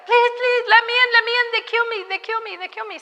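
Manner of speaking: high, frantic begging of a man fleeing for his life; desperate pleading. Retold or imitated. Man at the door begging the missionary to let him in, frantic and high. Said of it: "and he's like".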